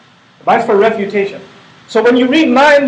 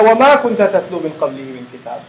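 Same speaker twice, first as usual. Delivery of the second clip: Quran recitation; 4 semitones lower, much faster than usual. Acted.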